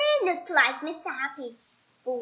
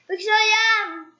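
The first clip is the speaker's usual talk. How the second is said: high, louder glum retort; sulky squeaky voice; 7 semitones higher, 7 dB louder than usual. Acted. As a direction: sulk it out. Mister Miserable sulks.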